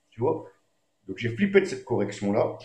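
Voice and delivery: normal talking voice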